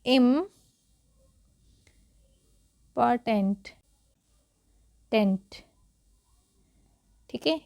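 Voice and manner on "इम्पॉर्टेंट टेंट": "इम्पॉर्टेंट" broken into parts with long pauses; syllable-by-syllable. Shown as a demonstration. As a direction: sound out इम्पॉर्टेंट piece by piece. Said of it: "क्या पढ़ते हैं?".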